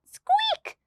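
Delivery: very high squeak; mouse squeak. Acted. Nervous mouse squeaking in fright. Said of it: "cried the mouse".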